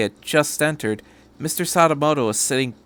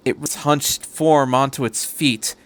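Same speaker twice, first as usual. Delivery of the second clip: ordinary delivery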